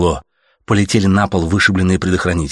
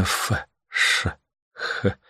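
soft, voiceless hissing noises; radio static hiss; 7 dB quieter, much slower than usual. A narrator's reading. Familiar noise over the music, imitated static hiss. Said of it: "шло знакомое".

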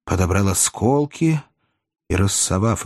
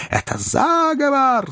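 very high, slow outcry; outraged accusation; slower than usual. Acted. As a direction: cry it out as an accusation, stretched high. Ben Allen accuses Pickwick.

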